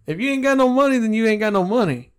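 exasperated retort. Retold or imitated. Arguing with dad about money, exasperated retort.

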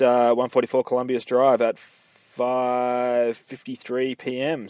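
ordinary talk

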